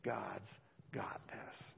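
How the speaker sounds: breathy near-whisper; soft voiceless God's got this; hushed reassurance. Shown as a demonstration. Pastor softly reassuring the congregation, nearly whispered. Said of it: "We shorthand that".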